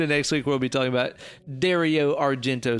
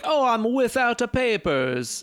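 higher, slow mock Italian; hammed-up stage Italian accent; 8 semitones higher than usual. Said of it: "he never says that".

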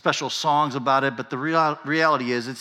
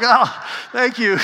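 sarcastic thanks; 10 semitones higher, 6 dB louder, slower than usual. Retold or imitated. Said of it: "It's like".

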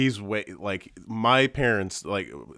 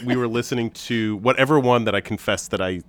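normal talking voice

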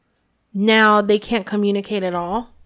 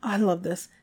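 ordinary talk, nothing marked

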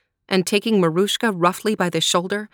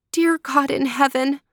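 higher, pleading prayer; freezing girl praying to God; 7 semitones higher than usual. Acted. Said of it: "she prayed".

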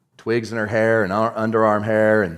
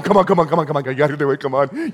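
rapid, lowered string of urging; acted cheering-on, tumbling out repeatedly; eager urging; 3 semitones higher, faster than usual. Saints urging you on.

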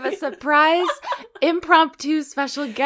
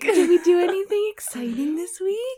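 kindergarten teacher voice; 7 semitones higher than usual. Acted. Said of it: "like we're kindergarten children".

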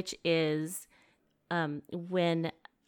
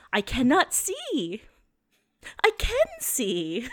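Abby imitation; 7 semitones higher, 5 dB louder, slower than usual. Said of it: "for some reason you can't see the map yet".

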